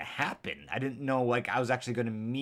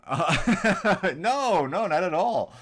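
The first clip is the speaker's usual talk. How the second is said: laughing; 7 semitones higher, 8 dB louder, slower than usual. Real, not acted. Laughing through a denial.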